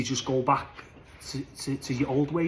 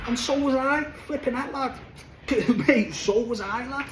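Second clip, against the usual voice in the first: sudden high squeaky confession; high excited admission; 9 semitones higher than usual. Real, not acted. Lad admitting to mates he was no better, pitched high. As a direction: jump way up in pitch, laughing at yourself.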